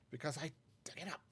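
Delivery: ordinary talk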